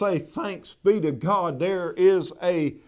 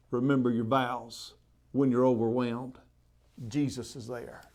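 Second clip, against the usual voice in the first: soft closing blessing; 6 semitones lower, 8 dB quieter than usual. Acted. Closing benediction, tender.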